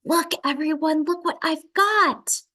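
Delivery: high, loud eager call to the class; can't hold it in, calling everyone to look; eager show-off. A narrator's reading. Lily interrupting class to show her purse, excited.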